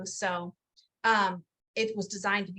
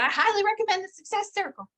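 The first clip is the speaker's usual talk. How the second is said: higher, louder, teasing copy of a friend's endorsement; imitating Suzanne; 10 semitones higher, 4 dB louder, faster than usual. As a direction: mimic her earnest recommendation playfully. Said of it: "That's how she would say it too".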